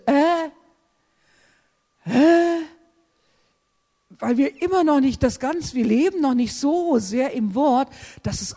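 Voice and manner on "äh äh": puzzled mock huh, rising; bewildered questioning grunt; confused huh. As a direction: play the baffled grumbler. Puzzled grumbling believer.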